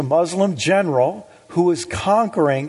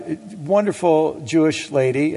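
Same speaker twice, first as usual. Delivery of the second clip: ordinary talk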